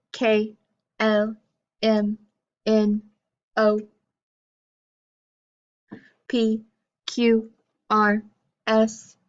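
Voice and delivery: stiff, evenly spaced robot letters. Shown as a demonstration. Teacher leading the class through the alphabet like a robot. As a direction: say each letter like a robot, pausing on the vowels. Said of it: "to do it like a robot".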